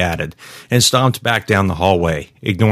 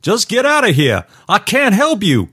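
high-pitched, contained yell; desperate yelling. Acted. Guard yelling at a woman he cannot help, desperate. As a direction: plead in a high, strained-pitch cry. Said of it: "Jim yelled at the screen".

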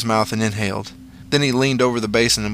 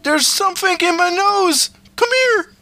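very high, frantic cry for help; panicked yell; 3 dB louder than usual. A narrator's reading. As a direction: yell across the house in a panic. Panicked Victor yells for help.

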